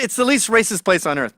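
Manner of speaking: ordinary talk, nothing marked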